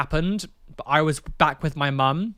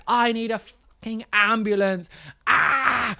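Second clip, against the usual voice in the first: high-pitched imitated screaming; retold frantic scream; 7 semitones higher than usual. Mum screaming down the phone for an ambulance, retold high scream. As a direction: imitate a desperate scream into a phone, held back in volume. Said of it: "she's just screaming down the phone".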